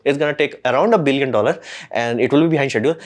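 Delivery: ordinary talk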